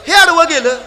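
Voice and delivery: high exclaimed cat thought; a cat complaining about the man; mock cat's outcry. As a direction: cry it out as the cat. Cat's view, mock cry.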